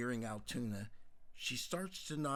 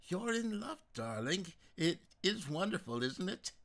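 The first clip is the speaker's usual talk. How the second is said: delighted; 8 semitones higher, 4 dB louder than usual. Acted. Mother delighted for daughter.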